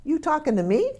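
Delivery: high, rising challenge as a quoted movie line; imitated movie challenge, sharply questioning; Robert De Niro. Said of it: "does Robert De Niro say".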